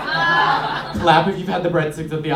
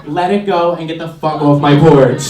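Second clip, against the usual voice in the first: mock yell; 9 dB louder than usual. Yelling at trick-or-treater.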